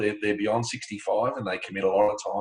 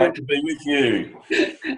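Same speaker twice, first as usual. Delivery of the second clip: laughing; 6 semitones higher, much slower than usual. Real, not acted. Guest laughing in reply.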